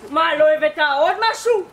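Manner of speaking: loud probing question. Acted. Psychologist probes suspiciously.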